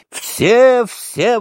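very high, excited cry; exclaiming. A narrator's reading. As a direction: exclaim it high, opening loud. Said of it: "восклицал Митя". (восторженный высокий возглас)

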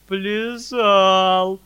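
loud tearful howl, voice cracking; sobbing wail. A narrator's reading. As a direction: wail it through sobs. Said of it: "проревел Фалалей, всхлипывая".

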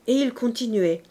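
ordinary delivery

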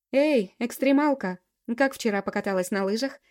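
bright teasing greeting; ringing cheerful voice. Acted. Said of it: "раздался звонкий голос Эльки".

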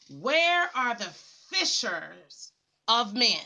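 slow, low, drawn-out preacher's question; slow searching call. Acted. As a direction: ask it slowly, as if searching the crowd. Preacher's rhetorical call to evangelists.

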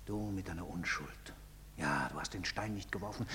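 low, soft and bitter; low bitter murmur. Acted. Father bitterly quotes his son, low and soft. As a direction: mutter it back at him.

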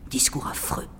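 breathy whispered aside. Acted. Poet reciting verse, whispering in mock horror. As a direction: hush it like a shocked secret.

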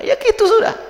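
hammed-up sudden dramatic swing; mock sudden outburst. Mocks preacher suddenly turning emotional.

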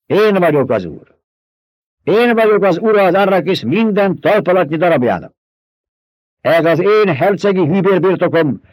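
Paul proclaiming. A narrator's reading. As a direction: declare it like a lord before his people. Paul's speech to the Fremen. (ünnepélyes, parancsoló hercegi hang)

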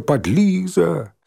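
high, slow, drawn-out jeer; shouted taunts. A narrator's reading. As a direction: stretch out each name like a playground chant. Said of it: "выкрикивая".

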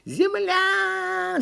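very high, drawn-out cry; stretched cartoon squeal; yelling monkey lookout. A narrator's reading. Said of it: "закричала «Почешись»".

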